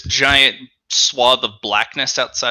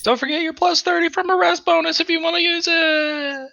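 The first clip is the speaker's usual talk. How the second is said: high-pitched reminder; 13 semitones higher than usual. Acted.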